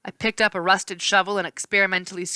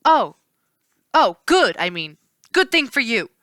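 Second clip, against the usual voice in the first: high, loud relief then bluff; relieved, then save face; flustered naiad; relieved then covering; 4 semitones higher, 6 dB louder than usual. A narrator's reading.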